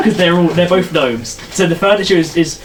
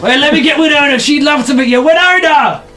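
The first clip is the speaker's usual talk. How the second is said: put-on high-pitched old man calling indoors; excited old gnome; 9 semitones higher, 3 dB louder than usual. Old gnome wants his wife to meet the visitor, calling out. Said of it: "a very old gnome".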